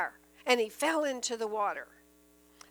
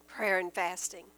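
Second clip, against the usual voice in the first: lower-pitched, measured answer; low, solemn; 6 semitones lower than usual. Real, not acted. Preacher answering how to strengthen belief, low and solemn. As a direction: drop the pitch on "Prayer and fasting". Answer given, then repeated.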